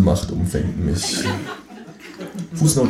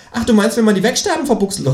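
high, fast teasing kangaroo; quick high tease; 4 dB louder, faster than usual. Acted. Kangaroo teases narrator about wordplay, high fast.

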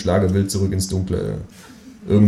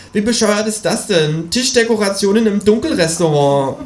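be the outraged kangaroo; indignant kangaroo; 13 semitones higher than usual. Acted. Kangaroo after eating table decoration, high indignant. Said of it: "Spuckt das Känguru aus".

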